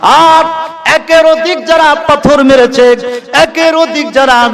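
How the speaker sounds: high, ringing chanted exhortation; fervent sermon cry; impassioned preaching. Real, not acted. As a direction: rouse the congregation on a high sustained pitch.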